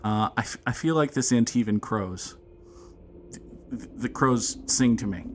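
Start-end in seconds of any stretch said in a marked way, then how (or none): none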